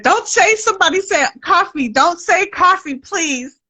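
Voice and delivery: high, pleading whine; mock pleading. Guest mock-begs host not to say coffee, pleading. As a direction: beg them not to say the word.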